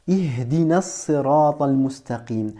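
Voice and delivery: Ihdina sirata al-mustaqim recited in careful Arabic; teacher models the Arabic verse; Arabic recitation. Shown as a demonstration. Teacher recites the Arabic verse to a learner, careful Arabic. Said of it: "Tu dis".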